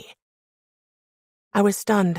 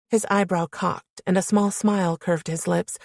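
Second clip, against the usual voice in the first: usual speaking voice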